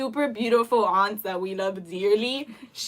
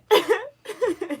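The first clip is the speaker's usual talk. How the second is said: high burst of laughter; 10 semitones higher than usual. Real, not acted. Laughing at awkward aunt story.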